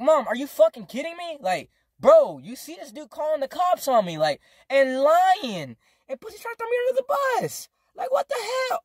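high rant building to a shriek; outraged outburst. Retold or imitated. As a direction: retell it in rising, squealing disbelief. Retold outburst at mom.

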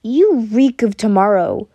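low, loud sneer of disgust. A narrator's reading. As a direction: recoil from a bad smell. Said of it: "in disgust".

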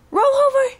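squeaky high coaxing; very high baby talk. Pretend. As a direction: coax it way up high. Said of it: "show everybody how you roll over".

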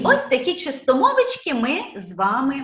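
normal talking voice

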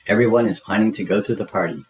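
usual speaking voice

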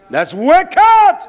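high, loud preacher's shout; explosive outcry, pitch leaping up; shouted condemnation. Real, not acted. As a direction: thunder it at the congregation. Repeats condemnation at full force.

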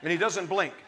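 ordinary delivery

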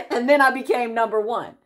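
breaking into a laugh as she brags; laughing proudly. Real, not acted. Laughs at her own success.

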